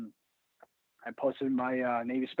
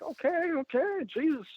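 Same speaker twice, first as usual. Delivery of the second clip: sarcastic alarm; 13 semitones higher, slower than usual. Retold or imitated. Reacting to death threats.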